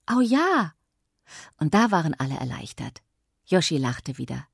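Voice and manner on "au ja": high, loud and delighted; excited cheer. A narrator's reading. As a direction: cheer with arms in the air. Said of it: "hob Yoshi die Arme und rief".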